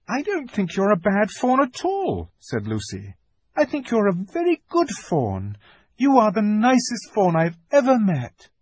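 put-on high, kind girl reassuring; a child soothing a friend; comforting girl Lucy. Lucy comforts the weeping fawn, kind.

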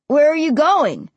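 high, loud call. A narrator's reading. Ray to Josh jogging away, calling. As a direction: call after someone walking off. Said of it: "Ray called".

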